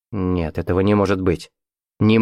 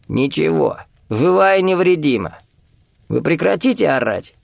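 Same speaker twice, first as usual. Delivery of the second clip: put-on testy old man; 6 semitones higher than usual. A narrator's reading. Старик из укрытия отвечает Виктору.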